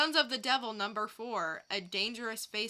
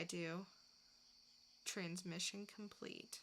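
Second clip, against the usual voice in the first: inner monologue; 6 dB quieter than usual. Acted. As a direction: think it quietly to yourself. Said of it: "dramatic reading".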